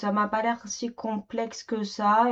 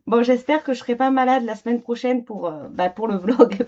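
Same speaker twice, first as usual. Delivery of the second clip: higher and louder, still choking; choking on a drink; 6 semitones higher, 7 dB louder than usual. Real, not acted. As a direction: push words out past a choke. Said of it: "avant que je m'étouffe".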